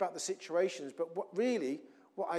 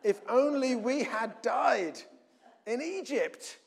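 higher, slow, whining Israelite lament; mock despairing whine, slow and higher; whiny complaint; 7 semitones higher, 5 dB louder, much slower than usual. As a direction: drag it out like a moaning grumbler. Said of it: "If ever there was the opposite".

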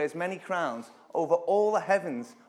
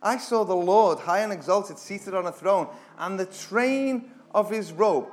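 louder, lingering on each word; fervent preaching; 3 semitones higher, 6 dB louder than usual. Acted. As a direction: proclaim it to the congregation. Repeats verse with emphasis.

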